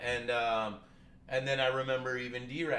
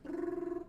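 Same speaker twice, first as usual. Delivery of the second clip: imitated phone ring, high and breathy. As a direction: buzz like a ringing phone. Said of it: "like, the phone ringing".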